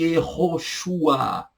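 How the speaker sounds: Yehoshua drawn out slowly, ye-ho-shu-a; slow Hebrew name. Shown as a demonstration. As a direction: stretch Yehoshua for the class.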